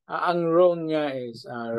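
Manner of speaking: ordinary delivery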